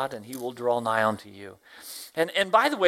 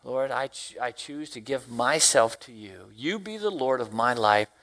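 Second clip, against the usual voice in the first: prayer; 3 semitones lower than usual. Acted. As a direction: give yourself to God in prayer. Speaker voices a morning prayer.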